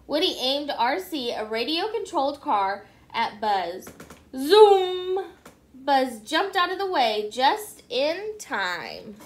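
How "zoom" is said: much louder, higher whooshing zoom; a loud sound-effect zoom for the car. Acted. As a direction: make it sound like a racing car. Toy car racing at Buzz.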